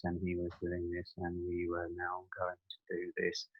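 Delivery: low, soft, slow droning mimicry; boring TV chef. Mocking the boring TV chef at his food demo, low soft drone. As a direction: drone on like a dull TV chef putting everyone to sleep. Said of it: "And he was talking like this".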